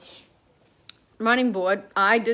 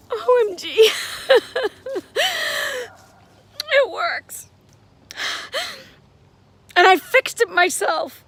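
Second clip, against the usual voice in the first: high, loud thrilled cry; can't believe it works, high and loud; 12 semitones higher, 7 dB louder, slower than usual. Real, not acted. Widow who fixed the light herself, thrilled and high.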